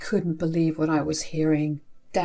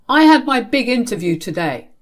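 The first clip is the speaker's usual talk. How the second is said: loud, raised-pitch reproach; accusing; 5 semitones higher, 6 dB louder than usual. Acted. Brother to sibling over missed interview, accusing. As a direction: state the grievance loudly.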